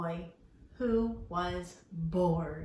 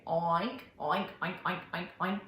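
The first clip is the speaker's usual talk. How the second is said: lightly voiced pig oinks for children; teacher doing pig noises; 2 semitones lower than usual. Shown as a demonstration. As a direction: oink like a pig in a story. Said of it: "What sound does a pig make?".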